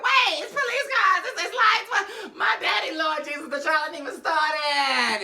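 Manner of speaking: high, loud frantic cries; panicked shout. Retold or imitated. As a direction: panic at the police lights. Pulane panics seeing police.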